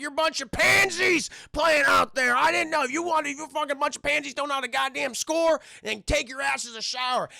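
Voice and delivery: high, loud ranting at players; hammed-up tirade, easing slightly by the end; yelling coach. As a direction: chew out a losing team. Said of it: "the coach is yelling".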